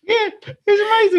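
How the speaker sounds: excited. Real, not acted. Gushing over the encounter.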